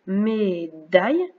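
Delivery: very slow "Médaille", split before "daille"; syllable by syllable. Shown as a demonstration. Teacher showing where the tongue makes the sound, syllable by syllable. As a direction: stretch "Mé", then sound out "daille". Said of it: "à la deuxième syllabe".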